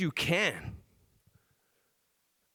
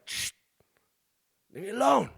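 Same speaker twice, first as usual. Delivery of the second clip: higher, sharper retelling of a brush-off; irritated snap; 7 semitones higher than usual. Retold or imitated. Dad retelling how he snapped at his thirsty son, irritated. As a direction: snap it after a shush, annoyed.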